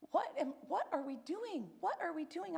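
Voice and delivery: high, strained retold despair; overwhelmed panic. Alone at home, crying out to herself in overwhelmed panic. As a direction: plead it like the ground just fell away. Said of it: "just overwhelmingly like".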